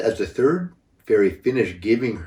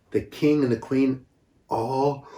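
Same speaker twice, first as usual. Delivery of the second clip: ordinary delivery, nothing marked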